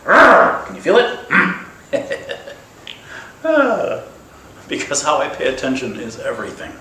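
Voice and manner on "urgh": frustrated groan. Acted. Venting frustration at the questions.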